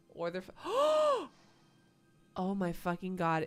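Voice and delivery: gasps, then blurts out in shock. Real, not acted. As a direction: caught off guard, real dismay. Perfect apple suddenly spoiled.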